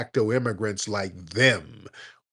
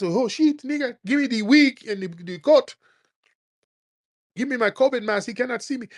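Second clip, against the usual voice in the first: mock panic; 10 semitones higher than usual. Mocking panicked immigrants hiding.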